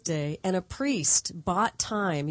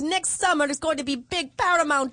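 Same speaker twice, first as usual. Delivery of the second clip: put-on higher, thick Sicilian accent; 8 semitones higher, 5 dB louder than usual. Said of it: "I'll do his, his accent".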